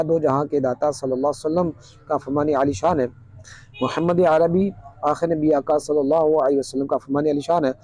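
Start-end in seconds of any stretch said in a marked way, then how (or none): none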